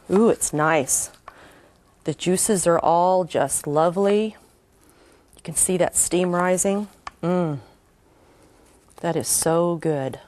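slow, soft, delighted murmur; drawn out and hushed, relishing it; savoring delicious food. Real, not acted. Tasting and admiring the dish.

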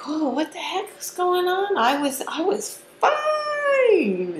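very high, loud, hammed-up freak-out; mock panicked wail. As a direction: wail it in panic, stretching the last word. Acts out sudden overwhelm, panicking.

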